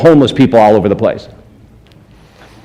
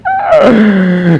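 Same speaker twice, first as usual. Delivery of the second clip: excited laughing cry; 8 semitones higher than usual. Real, not acted. Laughs at his awe.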